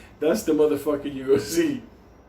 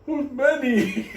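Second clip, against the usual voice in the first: nosy patient; 9 semitones higher, much slower than usual. Retold or imitated.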